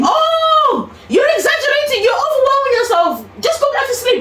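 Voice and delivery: high, mocking impatient nurse. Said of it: "nurse dismisses her at 1 AM".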